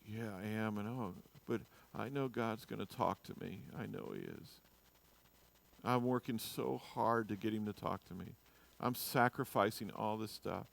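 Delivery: soft, low, put-on holiness; mock piety. Pharisee showing off his fasting, mock-pious and subdued. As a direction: play the weary martyr, quietly. Mimicking self-pitying, pious faster.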